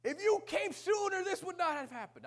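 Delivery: high, loud, slow pained cry; anguished plea. Retold or imitated. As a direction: cry it out to God in grief. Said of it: "I would tell".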